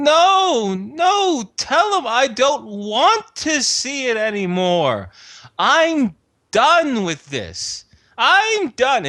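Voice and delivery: high, agitated cry of a frail woman; cracked frail voice. Acted. Frail queen refusing from the bedroom, cracked agitated cry. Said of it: "her cracked, frail voice being".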